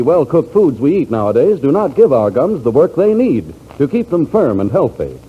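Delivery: ordinary talk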